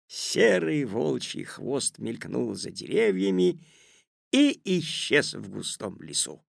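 ordinary delivery, nothing marked

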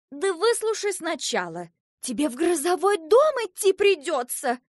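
high, loud, exasperated cry; distressed outburst. A narrator's reading. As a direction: cut the child off, nearly in tears. Bun cuts Asya off, then cries.

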